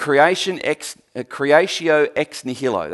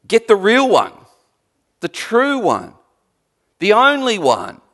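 higher, louder preacher's proclamation; emphatic preaching; 4 semitones higher, 6 dB louder than usual. Acted. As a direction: drive each phrase home to the congregation. Preaches at full force.

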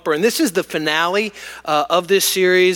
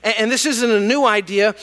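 usual speaking voice

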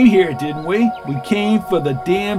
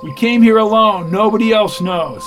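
high, loud insistence; defiant protest; 6 semitones higher, 6 dB louder than usual. Acted. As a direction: throw it back at the interrogator, voice raised. Prisoner protests under interrogation.

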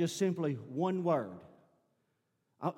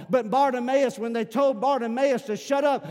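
loud, ringing preacher's cry climbing higher; preaching at full force; 5 semitones higher, 8 dB louder than usual. Real, not acted. Pastor rousing the church about Bartimaeus, loud and high preaching. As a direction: lift the congregation with a booming sermon.

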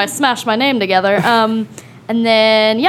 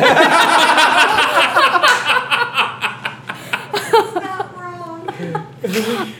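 laughing; 6 semitones higher than usual. Real, not acted. Laughing at own joke.

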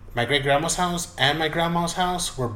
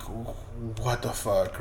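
stunned silent thought; 6 dB quieter, much slower than usual. Retold or imitated. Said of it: "but she didn't say it".

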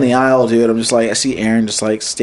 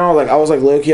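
usual speaking voice

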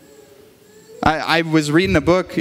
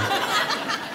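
breathy laugh; 8 dB quieter than usual. Real, not acted. Laughs at own mistake.

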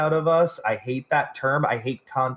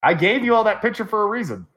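laughing; 7 semitones higher, faster than usual. Real, not acted. Laughing while protesting.

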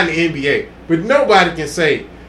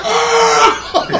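mock scream; 13 semitones higher than usual. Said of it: "just started screaming".

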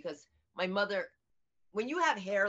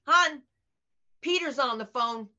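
higher, louder call; husband calling out; 6 semitones higher, 8 dB louder than usual. Retold or imitated. Husband calling his wife to the phone, higher and louder. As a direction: call across the house.

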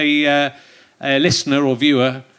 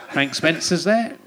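slow, lightly voiced Frank Spencer; a hint of Frank Spencer; Frank Spencer impression; slower than usual. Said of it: "I'm pretty good at the old, the old impressions".

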